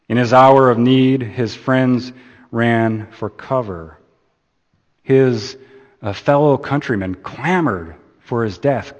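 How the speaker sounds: ordinary delivery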